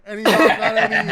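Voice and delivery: put-on loud laughing Southern accent, fast. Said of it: "Riley got me doing a fucking southern accent".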